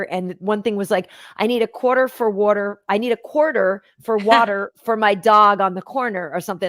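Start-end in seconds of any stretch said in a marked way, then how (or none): 1.4-6.3 careful r's in "quarter", "water" and "corner"; non-New-York pronunciation. Retold or imitated. Retelling a New Yorker's lesson, careful r's in "quarter". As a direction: enunciate "quarter for water", every r sounded. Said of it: "how to not say them like a New Yorker".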